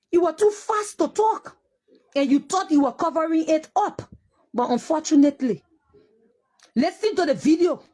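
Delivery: high, pained, accusing; hurt and furious, near tears; tearful anger. Real, not acted. Grieving mother accusing the police chief, high and tearful.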